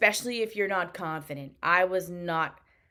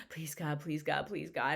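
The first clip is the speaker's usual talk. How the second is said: hushed desperate prayer, much softer; praying; 3 semitones lower, 8 dB quieter than usual. Retold or imitated. Opening the bar result email, a soft pleading prayer. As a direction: murmur a pleading prayer under your breath. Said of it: "I'm just like".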